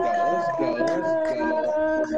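high, loud hype shout. Real, not acted. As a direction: fire up the crowd. Hype guest entrance cheer.

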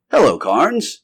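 bright-spirited call to a visiting friend; cheerful greeting. Acted. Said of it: "he called cheerfully".

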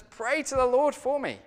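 mildly acted desperation; desperate plea. A narrator's reading. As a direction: read it as an urgent cry for help. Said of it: "in some level of desperation".

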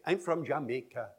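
Jamaican syllable-timed beat, full weight on every syllable; Jamaican accent. Shown as a demonstration. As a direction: say it with an even Jamaican rhythm. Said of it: "They say".

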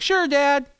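put-on high, breezy kid voice; cheerful kids. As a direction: toss it off like carefree children. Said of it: "They're always like".

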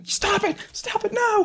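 very high squeaking pleas; tickled pleading. Acted. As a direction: squeal it like being tickled hard. Tickled character begging on floor.

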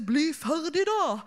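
drawn-out plea from the crowd, not truly shouted; imitated heckler's call. Voice from the audience to the performer, mock calling out. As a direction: stretch it out like a fed-up heckler. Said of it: "en stemme fra salen som ropte".

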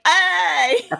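high, loud delighted yell; excited shout. Retold or imitated. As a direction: cheer it out with joy. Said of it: "I was like".

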